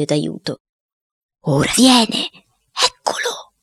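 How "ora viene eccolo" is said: high-pitched cook heralding a spirit; excited medium. Acted. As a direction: announce the spirit's arrival in a raised, eerie pitch. Cuoco ripete l'annuncio dello spirito. (medium eccitato, voce acuta)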